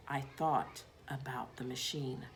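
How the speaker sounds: ordinary delivery, nothing marked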